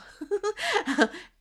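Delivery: bright burst of laughter; high laugh. Real, not acted. Laugh at own hiccups.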